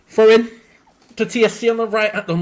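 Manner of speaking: sudden loud, high shout; loud call. Real, not acted. Fan calling out the throw-in near their box, loud. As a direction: call it out sharply.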